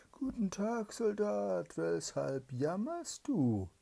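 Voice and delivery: high, loud, slow spirit voice; sly spirit. Acted. Evil spirit appears before the hungry soldier, high sly greeting. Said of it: "und sagte".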